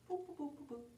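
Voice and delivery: soft, high little boops; imitated tiptoeing. Grandma pads back to bed, soft tiptoe boops. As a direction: trail off in tiny quick boops.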